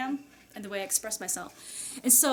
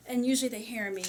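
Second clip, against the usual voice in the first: ordinary delivery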